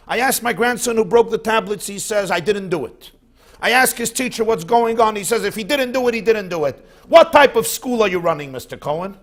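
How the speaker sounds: hammed-up angry complaint to the principal; infuriated grandfather. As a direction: demand the school be shut. Infuriated man confronts principal.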